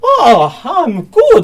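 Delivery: high nurse voice. Acted. Nurse voice in scene.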